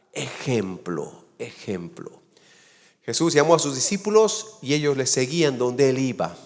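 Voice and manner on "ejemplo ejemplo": ejemplo said slow and low, fading softer the second time; hushed emphasis. Acted. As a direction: let ejemplo sink in, softer each time. Preacher drives home key word. (ejemplo, dicho lento y cada vez más suave)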